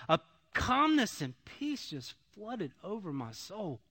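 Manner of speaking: ordinary talk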